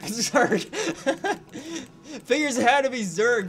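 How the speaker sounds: laughing in disbelief. Real, not acted. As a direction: laugh through the words. Laughing at facing Zerg.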